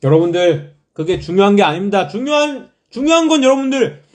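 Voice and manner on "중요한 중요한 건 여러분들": high, loud and thrilled; bursting with excitement; excited. Real, not acted. Excited announcement of group's win.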